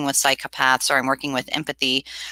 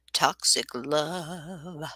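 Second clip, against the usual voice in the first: a hint of a drawn-out theatrical villain; Tim Curry impression; 8 dB quieter, much slower than usual. Viewer quotes a movie, streamer lightly mimics the villain slowly. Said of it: "Who was that? Tim Curry".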